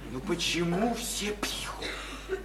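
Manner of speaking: very slow, hushed, voiceless question; barely audible, drawn-out lament; despairing whisper. Acted. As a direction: whisper it in bewilderment. Despairing question about the town drinking.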